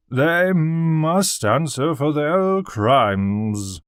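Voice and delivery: put-on very slow, loud drunken slur; slurring drunk. A narrator's reading. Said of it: "a slurring voice".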